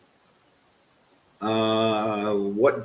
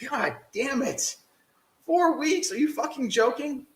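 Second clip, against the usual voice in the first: strained outrage, not quite shouting; incredulous rage. Real, not acted. Streamer disbelieving the game's timer, high incredulous outrage.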